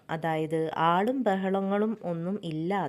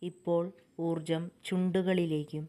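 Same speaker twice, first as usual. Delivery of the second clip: calm, softer meditation voice with long pauses; guided meditation; 2 semitones lower, 3 dB quieter than usual. Acted. Meditation guide leading listeners through breathing and rising energy, calm and measured. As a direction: guide them gently through each breath and body part, unhurried.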